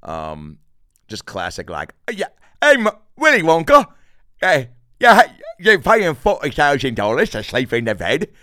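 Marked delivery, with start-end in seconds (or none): 2.1-8.3 high, loud manic put-on voice; Fire Marshal Bill impression. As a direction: play a wild-eyed hustler, yelling. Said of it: "She's like Fire Marshal Bill".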